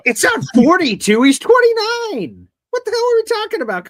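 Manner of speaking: loud, very high-pitched protest; outraged correction. Real, not acted. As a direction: burst out in loud disbelief at your co-host. Heated correction of co-host's age claim.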